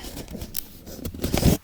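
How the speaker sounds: loud breathy laugh bursting out; burst of laughter. Real, not acted. Laughing as she retells the boss's visit.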